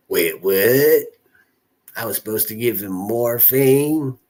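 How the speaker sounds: loud, put-on Southern drawl of a nurse; mocked Southern accent. Streamer mocking a Southern nurse to chat, in a put-on Southern accent. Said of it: "I feel so bad even mocking her accent".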